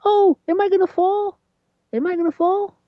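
high granny voice in awe and mild fright; old grandmother voice. Retold or imitated. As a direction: squeak it out like an amazed granny afraid to fall. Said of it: "she's like".

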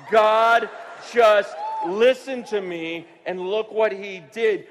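loud, slow, amazed exclamation; awestruck, booming delivery; exultant amazement. Acted. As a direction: proclaim it in loud wonder, stretching the words. Exulting over an answered prayer.

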